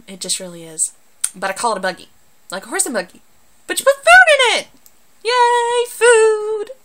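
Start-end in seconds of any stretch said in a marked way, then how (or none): 3.7-4.7 mock outrage. Mock outrage about grocery cart. 5.2-6.7 high, loud squeal of joy; mock cheer. Cheering about food in cart.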